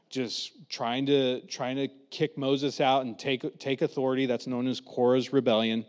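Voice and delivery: usual speaking voice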